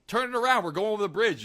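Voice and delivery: lightly acted hurried command; officer's order. Officer to tank guy, mildly urgent order. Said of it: "He goes".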